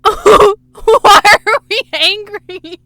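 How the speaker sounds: high, loud, drawn-out whine; stretched high mock cry; mock wail. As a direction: wail it out in hammed-up distress. Mock reply to screaming cashier.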